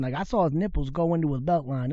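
ordinary talk

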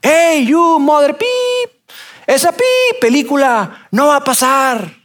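high, slow hostile shout; repeated distant yell. Retold or imitated. The same stranger shouting again, high and slow. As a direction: drag out the threat from afar. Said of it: "Y otra vez".

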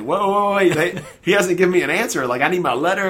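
high, loud objection, easing slightly towards the end; retold protest, half laughing as it bursts out; alarmed protest. Father told to leave without his letter, alarmed protest. Protests being hurried out unanswered.

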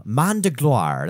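a self-mocking French accent on Main de Gloire; terrible French accent. Shown as a demonstration. Reading out the French origin of Hand of Glory in a bad French accent. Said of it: "That's my terrible French accent".

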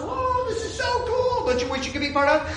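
very high, giddy showing off; gleeful boasting. Acted. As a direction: brag to the neighbours in giddy wonder. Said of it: "we should be telling all the other nations".

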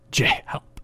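breathless, voiceless plea for help; whispered gasp for help. A narrator's reading. Emma choking and begging Jay for help, breathless. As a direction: gasp it out with no air. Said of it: "Emma gasped".

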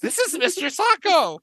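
high-pitched character voice; squeaky put-on wrestler; mock wrestler. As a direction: introduce a sidekick in a silly high voice. Continuing the wrestler impression.

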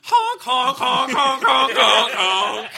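loud high honking like a clown horn. Acted.

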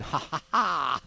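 a slow, menacing Bond villain chuckle; villain laugh. Acted. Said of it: "sort of a James Bond villain type voice".

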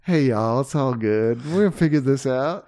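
easygoing put-on Texan accent; mock Texan accent. Co-host playing reassuring Texans, mock Texan accent. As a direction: play a folksy Texan. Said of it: "we're from Texas".

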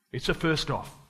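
ordinary delivery

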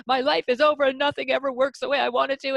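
high whiny meltdown voice; hysterical kid. Retold or imitated. Mother imitating her son's Lego meltdown, high and whiny. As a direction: mimic a child losing it over Lego. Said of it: "now he's hysterical".

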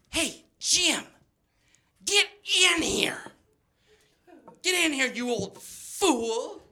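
high, slow sing-song beckoning; flirty grandma. Retold or imitated. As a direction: coax him in like a coy old lady. Grandma flirting from her wheelchair.

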